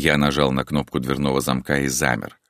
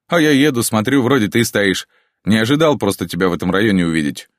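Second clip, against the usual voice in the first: higher, louder, quick cheerful chatter; cheerful friend; 6 semitones higher, 5 dB louder than usual. A narrator's reading. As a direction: voice an upbeat friend. (бодрая болтовня Антона)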